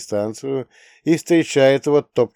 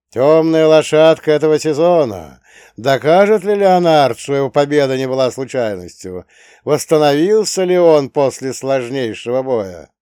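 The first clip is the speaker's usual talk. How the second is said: arena announcer; 4 semitones higher, 7 dB louder than usual. A narrator's reading. Announcer hypes the fighter.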